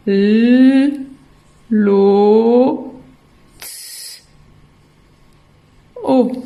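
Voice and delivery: "l, lo, t, o" built up with long pauses; sound by sound. Shown as a demonstration. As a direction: hold "l" and "lo", hiss a voiceless "t", then give "o". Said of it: "ça s'écrit".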